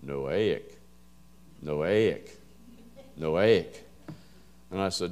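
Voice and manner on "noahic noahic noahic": "Noahic" as no-AY-ick, three syllables in a low, measured voice; "Noahic" with AY in the middle, spaced out like a recorded guide; imitating pronunciation voice. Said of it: "And the voice says".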